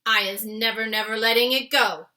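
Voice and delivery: loud fierce vow; loud determined giant's vow; determined cry. A narrator's reading. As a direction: swear it fiercely. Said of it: "the B F G cried".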